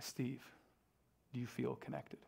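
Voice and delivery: hushed, deadpan serious question; mock serious. Young pastor quizzing Steve at the door, hushed deadpan. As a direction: ask it dead straight and soft. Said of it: "I look at him real serious".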